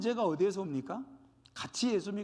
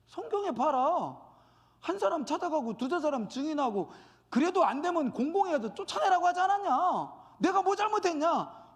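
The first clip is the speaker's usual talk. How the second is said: raised, high-pitched protest building as it goes; indignant self-justification; 6 semitones higher than usual. Acted. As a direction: argue hotly in mock indignation. Acting indignant self-justifying church member. (따지듯 언성을 높여)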